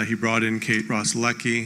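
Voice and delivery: ordinary delivery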